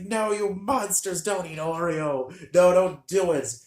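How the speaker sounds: high-pitched, imitated crying plea; mock tearful protest. Brother begging the family not to eat his rabbit, mock crying. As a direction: plead like an upset child, hammed-up and high. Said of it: "he started crying".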